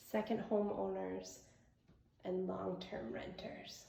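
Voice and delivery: normal talking voice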